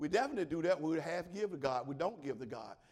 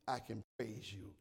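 normal talking voice

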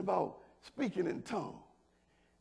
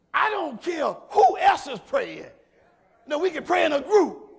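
loud, higher preacher cry; preaching at full force; 9 semitones higher, 9 dB louder than usual. Acted. Pastor declaring to the church that each prayer is heard, loud. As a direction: call it out with conviction.

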